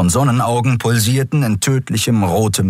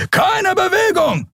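very high, drawn-out shout; screamed order. Acted. Guard stopping fugitives in the alley, screamed order. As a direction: yell a warning at the top of your register. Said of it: "schrie ein Weißmantel".

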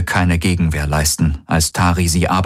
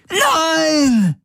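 high, piercing screech. A narrator's reading. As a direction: screech it out in agony. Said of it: "Galens Stimme kreischen". (gequältes Kreischen)

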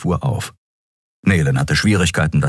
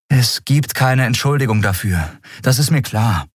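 higher-pitched, matter-of-fact admission; sober; 7 semitones higher than usual. A narrator's reading. Woman admitting fault to her crew, soberly. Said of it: "erklärte sie nüchtern".

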